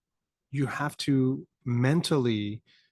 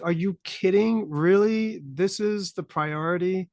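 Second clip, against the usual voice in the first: high-pitched, exasperated disbelief; mock incredulous; 7 semitones higher than usual. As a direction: question it as if it's absurd. Acts out disbelief at neocon priorities.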